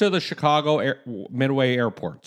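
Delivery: a light Chicago airport announcement; Chicago accent. Pretend. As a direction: welcome them in a Chicago accent. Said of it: "That's a Chicago accent".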